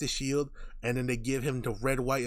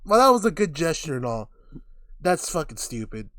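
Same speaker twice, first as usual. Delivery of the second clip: ordinary talk, nothing marked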